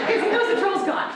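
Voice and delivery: ordinary delivery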